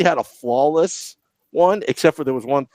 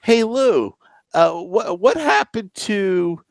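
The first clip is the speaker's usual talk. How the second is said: higher-pitched mock reporter calling out; press conference reporter; 8 semitones higher, much slower than usual. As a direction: pipe up with a question for the coach. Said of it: "a press conference and be like".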